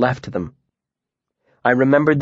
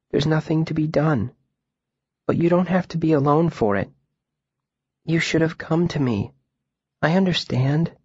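put-on young girl voice; 4 semitones higher than usual. A narrator's reading. Ari voiced high, comforting.

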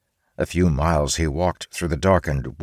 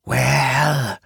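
high drawn-out hiss of a word; hissing spider; 9 semitones higher than usual. Acted. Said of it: "a loud hissing sound interrupted his thoughts".

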